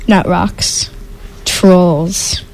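ordinary delivery, nothing marked

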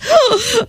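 high startled intake of breath; sharp gasp. Acted. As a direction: gasp in shock. Mother shocked by transformation.

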